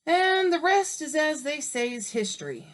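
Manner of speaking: high and loud, then settling; dramatic aside. Acted. Wolf telling listeners the rest, grandly. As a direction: make it grand.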